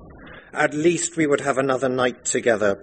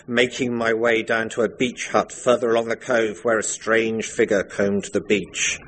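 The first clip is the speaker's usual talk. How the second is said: usual speaking voice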